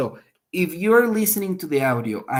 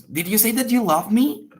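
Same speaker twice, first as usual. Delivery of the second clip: higher pitch climbing to a surprised question on ME; questioning repeat; 7 semitones higher, 4 dB louder than usual. Shown as a demonstration. Second person repeating a love confession to check, questioning repeat. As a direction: echo it back as a surprised question. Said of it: "They are repeating the information".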